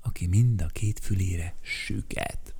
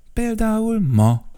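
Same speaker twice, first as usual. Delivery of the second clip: much higher, louder, put on the spot; cornered; 12 semitones higher, 5 dB louder than usual. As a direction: blurt it out, cornered. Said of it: "mondta sarokba szorítva". (sarokba szorítva, magasan kibökve)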